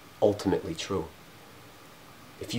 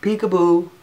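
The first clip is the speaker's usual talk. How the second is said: sing-song peekaboo, higher and louder; God hiding behind a mask, teasing peekaboo; playful God teasing; 7 semitones higher, 6 dB louder than usual. Acted. God playing hide and seek, teasing peekaboo. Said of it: "He's saying".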